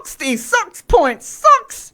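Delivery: very high-pitched mock Kiwi; hammed-up New Zealand accent. Radio host back-announcing a song, mock Kiwi accent. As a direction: squeal it out like a cartoon New Zealander. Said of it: "just New Zealand accent".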